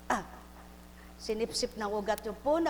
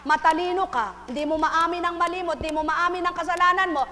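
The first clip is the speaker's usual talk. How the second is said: loud, higher rebuke to the flock; preaching at full force; 6 semitones higher, 8 dB louder than usual. Acted. Preacher scolding the congregation, loud and higher. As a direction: thunder it from the pulpit.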